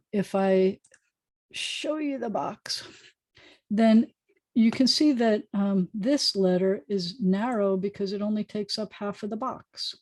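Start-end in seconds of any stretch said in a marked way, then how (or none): none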